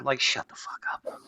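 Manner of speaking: whispered dismissive aside; whispering. Real, not acted. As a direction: hiss it under your breath. Dismisses critics under breath.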